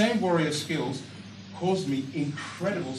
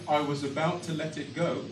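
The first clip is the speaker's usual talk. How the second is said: ordinary talk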